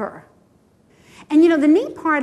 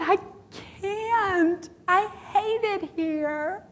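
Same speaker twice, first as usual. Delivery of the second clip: retold sobbing; 4 semitones higher, 4 dB quieter, much slower than usual. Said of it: "when I was sobbing hysterically".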